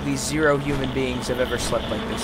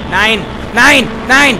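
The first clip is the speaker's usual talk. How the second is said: escalating loud shouts of Nine, higher each time; yelling nine; 7 semitones higher, 10 dB louder, slower than usual. Real, not acted. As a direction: bark the word Nine louder and higher every time. Player yelling commands at game.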